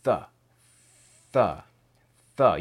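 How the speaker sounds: repeated soft th, air pushed between teeth; th sound drill. Shown as a demonstration. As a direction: puff out th sounds one by one. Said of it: "make that letter sound".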